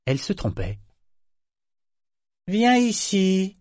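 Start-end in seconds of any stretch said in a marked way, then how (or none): 2.5-3.6 high, slow, drawn-out command; slow imperious summons; commanding order. A narrator's reading. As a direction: summon her like a servant. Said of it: "ordonna-t-il".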